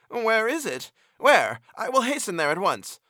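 high, urgent and eager; excited. A narrator's reading. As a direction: burst out, can't wait to go. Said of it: "beside himself with excitement".

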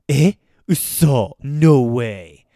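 loud, drawn-out Eh, uso, no way; mock disbelief. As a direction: act out playful shock at the gossip. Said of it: "And you'll respond".

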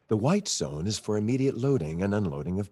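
PA announcer voice; airport announcement. Shown as a demonstration. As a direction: read it like a terminal PA. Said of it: "We do murder, you know, zombies".

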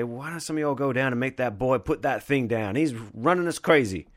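amateur Southern storyteller impression. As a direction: quote an old bluesman in a Southern accent. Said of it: "That was a Southern accent".

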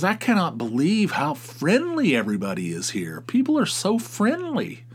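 lightly voiced starry-eyed tourist, rising to a high gush; gushing tourist woman. Tourist woman gushing to a security guard, mock high gushing.

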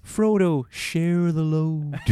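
slow, strained movie line; movie quote voice. Acted. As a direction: drawl it like a film hero. Quoting a film line.

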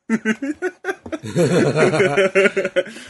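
long laugh rising in pitch. Real, not acted. Cracking up after a joke about the glasses, long laugh. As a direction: crack up and let the laugh run.

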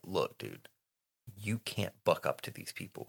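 very soft, low, serious counsel; shaken warning. Retold or imitated. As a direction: imitate a teacher quietly warning a student. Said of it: "McGonagall is shaken".

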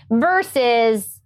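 ordinary delivery

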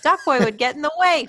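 high-pitched, lilting fond reminiscence; nostalgic Jon. Retold or imitated. Acting Jon reminiscing to Garfield.

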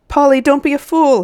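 high, loud warning cry; urgent shout. A narrator's reading. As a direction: shout to stop her at once. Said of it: "he shouted".

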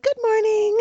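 "Good morning" way up high and chipper; inappropriately cheerful. Retold or imitated. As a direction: chirp "Good morning" an octave above. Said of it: "an octave higher than them".

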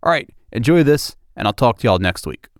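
usual speaking voice

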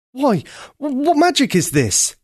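high, stammering astonished prince; amazed cry. A narrator's reading. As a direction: gasp it out in wonder. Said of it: "he cried".

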